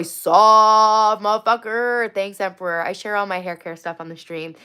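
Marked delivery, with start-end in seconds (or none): 0.0-2.1 loud, stretched-out jeer; slow, mocking insult; drawn-out taunt. Taunting the match.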